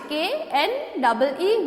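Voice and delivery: ordinary talk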